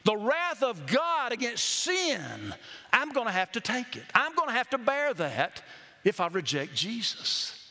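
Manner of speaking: normal talking voice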